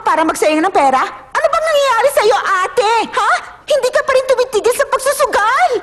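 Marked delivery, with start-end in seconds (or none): 1.1-5.7 high-pitched, exasperated telling-off; scolding yell. Acted. Confronting older sister about her gambling, scolding yell. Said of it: "Wag mo naman akong sigawan".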